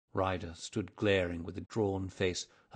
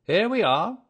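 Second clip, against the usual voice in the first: bright cheerful call, much higher and louder; cheery; 13 semitones higher, 13 dB louder than usual. A narrator's reading. Holmes ushering a guest into the sitting-room, cheery. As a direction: sing out a hearty, high-pitched welcome. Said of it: "said Holmes cheerily".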